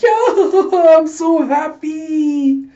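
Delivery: very high squealing laugh, drawn out; loud cartoon-villain ecstasy; manic glee. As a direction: cackle and squeal with mad delight. Villain overjoyed, cramming flan.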